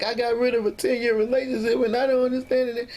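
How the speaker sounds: high-pitched reenacted distress; upset, tearful retelling. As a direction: plead as if worked up to tears, without sobbing. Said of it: "I'm crying".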